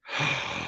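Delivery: sharp intake of breath; shocked gasp. Real, not acted. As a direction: gasp at the bad news. Shocked by his own math result.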